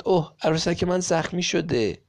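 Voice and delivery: hammed-up cry that the doll is wounded; mock cry of alarm. A narrator's reading. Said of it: "نیل فریاد زد".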